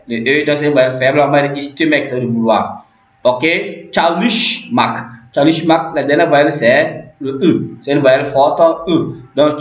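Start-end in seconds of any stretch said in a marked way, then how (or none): 3.9-5.9 Chalushmak said slowly at a higher pitch, middle syllable stretched; drawn-out word model. Shown as a demonstration. Teacher introducing a Turkish verb, slow careful model. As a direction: stretch out Chalushmak for learners to copy.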